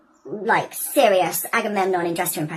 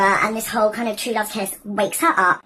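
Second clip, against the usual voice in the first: ordinary delivery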